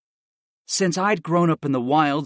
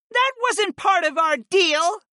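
high, slow, sulky goddess pout. A narrator's reading. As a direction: sulk like a spoiled child. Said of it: "Phi pouted".

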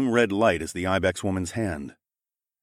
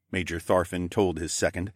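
ordinary delivery, nothing marked

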